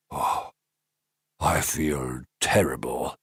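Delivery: a poorly king moaning softly; weak sick king. Acted. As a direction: sound feeble and ill.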